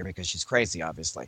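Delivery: usual speaking voice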